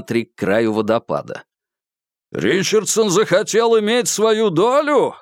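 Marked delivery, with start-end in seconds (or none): 2.3-5.1 shouting. Acted. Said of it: "крикнул Курц".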